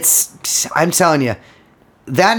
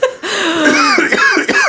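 fake cough. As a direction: cough to drown someone out. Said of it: "I'm gonna cough over you".

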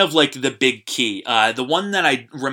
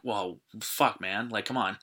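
softly, a little lower, under the breath; hushed mock disbelief; 3 semitones lower, 12 dB quieter than usual. Podcaster voicing a reaction to a totally jacked guy, hushed disbelief. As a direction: drop to a quiet, awed aside.

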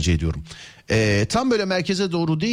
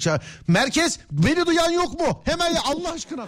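high, slow, pleading radio call; desperate radio call; 13 semitones higher than usual. Pretend. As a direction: beg the base for an answer. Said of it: "Üçüncü de ölecek ya artık".

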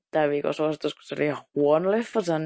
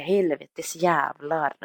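slow, drawn-out helvettes jävlar; mock Swedish swearing; much slower than usual. Shown as a demonstration. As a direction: stretch out helvettes jävlar like a children's song. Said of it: "kuulostaa ... lastenlaululta".

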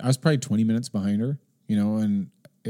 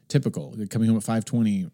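usual speaking voice

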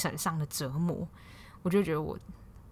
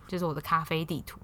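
ordinary talk, nothing marked

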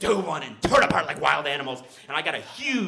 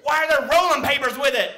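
suspicious cop; 12 semitones higher, 7 dB louder than usual. Retold or imitated. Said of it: "the highway patrolman was like".

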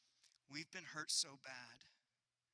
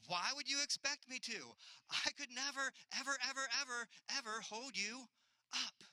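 put-on higher, louder kid protesting; imitating son; 8 semitones higher, 4 dB louder than usual. Son telling his dad he could never hold him, high and insistent. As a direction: play the son, baffled and insistent.

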